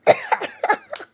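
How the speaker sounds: high burst of shocked laughter; a sudden, helpless laugh; startled laugh. Real, not acted. Streamer caught off guard.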